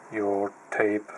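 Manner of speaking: ordinary talk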